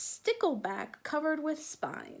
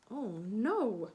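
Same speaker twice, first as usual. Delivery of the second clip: plain reading voice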